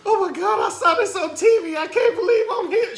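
high, loud, teary gush; overwhelmed and choked with emotion; imitating her crying. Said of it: "she said".

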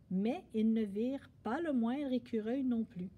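usual speaking voice

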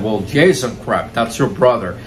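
ordinary talk, nothing marked

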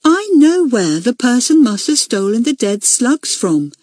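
put-on important little girl, pitched high; self-important. A narrator's reading. Junior girl telling the meeting what she knows, self-important. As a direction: announce it with great importance. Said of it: "she said importantly".

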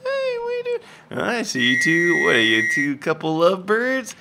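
very high squeaky teasing voice; squeaky high character. Pretend. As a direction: tease like a tiny creature.

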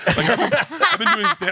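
high laughing voice, breaking up. Real, not acted. Telling the podcast host, laughing high and loud. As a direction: laugh through the words, pitch way up.

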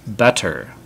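ordinary talk, nothing marked

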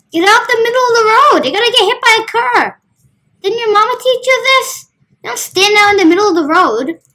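high, loud scolding shout; mock road rage, shouting at the lady; road rage yell. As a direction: yell at a jaywalker. Yelling at pedestrian in game.

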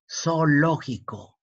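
"Zoológico" said slowly, syllable by syllable. Shown as a demonstration. Teacher sounding out "Zoológico" slowly for the pupil. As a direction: draw out "Zoológico" so each vowel is heard. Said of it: "Qué pasa con zoológico".

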